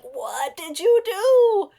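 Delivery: high, loud, drawn-out mock outburst; acted angry yell. Mother reenacting yelling at her kids over spilled coffee, mock-furious yell. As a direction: yell it slowly in hammed-up outrage. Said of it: "I'm sorry that I yelled at you guys".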